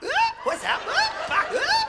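very high, loud shocked gasps; shocked high squeal. Acted. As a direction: squeal in horror.

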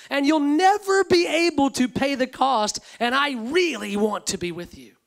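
loud, building sermon voice; loud, slow, proclaiming the gospel; full-force preaching. Acted. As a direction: preach it out with rising fervor.

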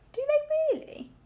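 very high, loud piglet question; amazed piglet. A narrator's reading. Piglet hearing how wolves treat sheep, amazed. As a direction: ask it wide-eyed, pitched right up.

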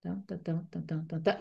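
soft "ta, ta, ta" tapping out the verse's meter; wordless metrical beat, spoken softly; beating out rhythm. Shown as a demonstration. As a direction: mark each stressed beat on a quiet "ta". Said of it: "c'est déjà de la musique".

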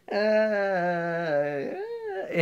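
high squeaky "ah, ah" stalling; a panicked high-pitched stalling noise; boy squeaking nervously to dodge a kiss. Retold or imitated.

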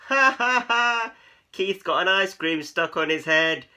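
loud, high mocking laughter; chuckling cats. A narrator's reading. Cats teasing Keith about the ice cream, loud chuckling. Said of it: "chuckled the other cats".

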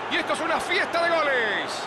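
normal talking voice

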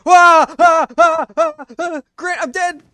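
high panicked screams; panicked screaming. Real, not acted. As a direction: scream as you die. Dying in the game, screaming.

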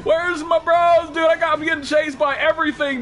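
high, loud, drawn-out panic yell; real terrified shouting for help; panicked shout. Real, not acted. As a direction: scream for your friends like you're about to die. About to die in game.

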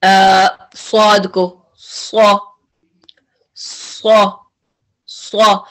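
स्वा repeated slowly, thick and full-mouthed, once almost whispered; heavy syllable demo. Shown as a demonstration. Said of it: "Like आप पढ़ते हैं".

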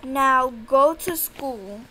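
loud, slow, firm command; stern order. Acted. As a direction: send him off like a fed-up mom. Mom orders son to school.

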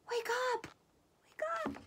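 very high, panicky call; urgent cry. A narrator's reading. Bear scared by noises rouses Mouse, urgent high cry. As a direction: cry out to wake someone, voice shooting up. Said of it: "He's crying at the mouse".

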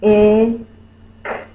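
I, k loud and stretched apart; sounding out words. Shown as a demonstration. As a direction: sound out I and k slowly. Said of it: "Let's sound it out".

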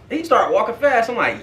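usual speaking voice

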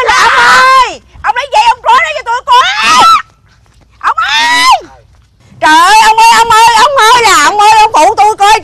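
loud high calls; yelling for husband. Acted. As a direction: shout to bring him running. (vợ gọi chồng, la lớn)

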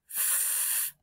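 whispered soft "F" as a breathy fff; the f sound only, no vowel. Shown as a demonstration. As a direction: blow out a quiet "F". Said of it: "Do that with me".

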